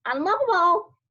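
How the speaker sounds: high squawked word like a parrot. A narrator's reading. Parrot squawks at Alfred, high and shrill.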